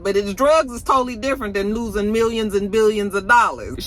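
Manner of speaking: ordinary delivery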